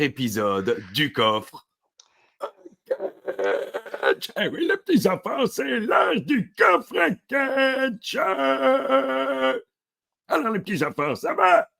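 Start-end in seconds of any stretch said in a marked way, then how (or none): 4.4-11.7 put-on high old man calling out to the little children; a high, hammed-up grandpa bellowing his welcome. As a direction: greet the kids like a creaky old papy. Old papy greets the children.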